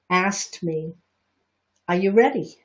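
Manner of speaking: normal talking voice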